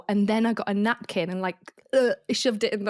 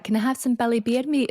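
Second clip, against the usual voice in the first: higher Scottish mum at the counter; imitated Scottish accent; 4 semitones higher than usual. Mum orders at the deli counter, imitated Scottish accent. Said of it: "obviously my mum's Scottish".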